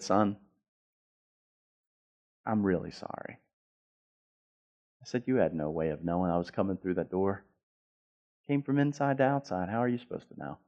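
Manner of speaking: soft, subdued, sincere sorry; gentle apology. Retold or imitated. Father saying sorry to his son, gentle apology. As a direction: speak low and softly, with long pauses.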